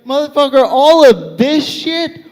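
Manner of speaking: mock-outraged and emphatic; indignant comeback. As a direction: set them straight, punching it out. Indignant comeback to patronizing strangers.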